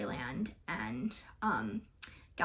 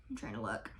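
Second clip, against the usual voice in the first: muttered aside; 13 semitones lower, 9 dB quieter than usual. Real, not acted. Searching her phone mid-sentence.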